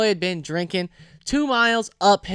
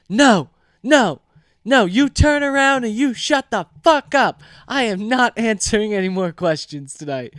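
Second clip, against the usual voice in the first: loud, higher, emphatic refusal; mock angry outburst; 4 semitones higher, 5 dB louder than usual. Drunk Pat refusing any questions, mock furious. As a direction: imitate an indignant friend shutting everyone up.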